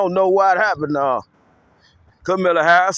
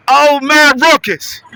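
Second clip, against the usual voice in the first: dramatic cry; 6 semitones higher, 7 dB louder, slower than usual. Acted. Dramatic exclamation.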